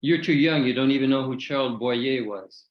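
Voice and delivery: ordinary talk, nothing marked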